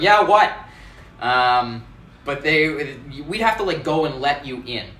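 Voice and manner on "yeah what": high, loud, rude answer; bad impression of herself. Mocking her own rude phone voice, loud and high. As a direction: bark it like a grumpy doorkeeper. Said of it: "a really bad impression of me going".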